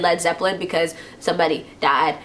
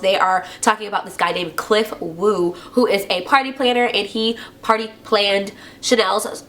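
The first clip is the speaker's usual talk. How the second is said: usual speaking voice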